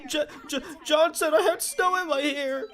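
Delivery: put-on high, stuttering, tearful little-girl voice; a girl tattles to the teacher in a mock quivering voice; mock crying girl. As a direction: imitate a kid about to cry, stammering. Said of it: "She started getting the quivering lip".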